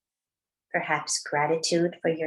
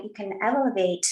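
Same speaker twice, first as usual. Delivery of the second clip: ordinary talk, nothing marked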